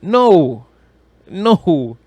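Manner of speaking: normal talking voice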